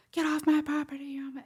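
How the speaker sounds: high, loud screamed imitation; mock screaming. Cottagers chasing walkers off the public path, mock screaming. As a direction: imitate an aggressive property owner screaming. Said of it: "scream at you".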